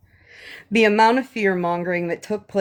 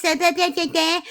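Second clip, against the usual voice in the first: mock baby voice; 6 semitones higher, faster than usual. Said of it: "in her baby voice".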